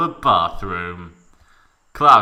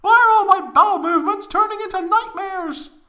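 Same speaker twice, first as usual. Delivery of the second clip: squeaky falsetto lament, louder; whiny high Tifa; 8 dB louder, faster than usual. A narrator's reading. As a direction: wail it like a cartoon girl.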